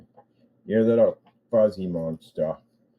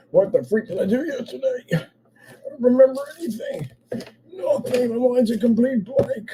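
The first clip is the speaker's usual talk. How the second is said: groggy hungover reveller. Pretend. Asking viewers what happened last night, in a high dazed voice. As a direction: play a confused partygoer the morning after. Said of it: "waking up from hangovers".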